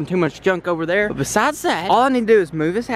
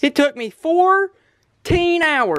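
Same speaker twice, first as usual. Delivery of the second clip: slow boast; 7 semitones higher, much slower than usual. Real, not acted. Bragging slowly.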